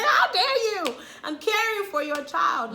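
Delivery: loud, high, laughing indignation; how dare you, loud and high with a laugh; retold outrage. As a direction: let it burst out, half laughing. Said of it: "I wanna use expletives on her".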